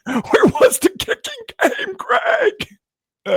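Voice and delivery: put-on old man voice, laughing. Host grilling coach Greg, laughing old man voice. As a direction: try to hold the old-man character through the giggles. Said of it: "top-notch impression".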